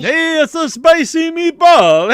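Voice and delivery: high, slow, hammed-up Italian accent. Co-host shows off Italian on request, hammed-up Italian accent. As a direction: sing out a cartoonish Italian accent, stretched. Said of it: "Say it in an Italian accent".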